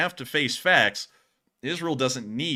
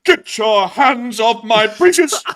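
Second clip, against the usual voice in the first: high, loud, slow outraged protest; fluttering cries for help; 10 semitones higher, 12 dB louder, slower than usual. Pretend. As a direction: cry out like a manhandled old gent. Succulent Chinese meal meme.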